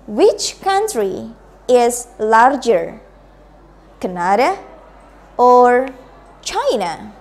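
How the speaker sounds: slow model reading, "Canada" rising, "China" falling; choice-question intonation. Shown as a demonstration. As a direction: lift "Canada", pause, hold "or", then drop on "China". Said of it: "listen to me and then you can repeat".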